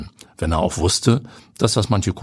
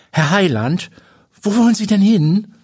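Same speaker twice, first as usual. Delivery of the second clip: sharp calling guide; 13 semitones higher, 5 dB louder than usual. A narrator's reading. Guide calls out to Habe.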